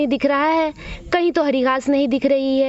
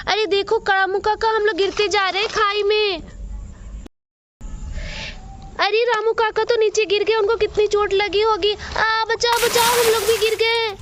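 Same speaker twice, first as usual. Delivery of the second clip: put-on much higher, frightened child cries; panicking children; 6 semitones higher than usual. Children falling into the ravine cry out to Ramu kaka, panicked. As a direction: shriek for help as you tumble down.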